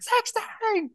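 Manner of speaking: high squeal. Retold or imitated. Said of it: "Home Alone moment".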